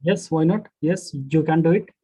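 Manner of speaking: usual speaking voice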